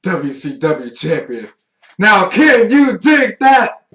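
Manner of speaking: Booker T style shouted promo, high and loud; slow, booming heel promo yell; wrestling promo. Retold or imitated.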